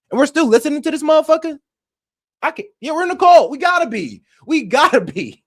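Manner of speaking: venting real anger at the community; angry rant. Real, not acted. Streamer to chat, furious and high. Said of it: "it really did make me mad".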